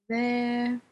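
tight, stretched-out single word. A narrator's reading. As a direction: say it tensely, holding the word. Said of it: "Blair said tightly".

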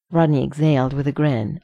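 usual speaking voice

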